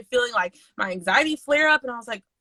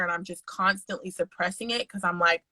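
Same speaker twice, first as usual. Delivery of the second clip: normal talking voice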